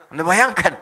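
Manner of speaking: ordinary talk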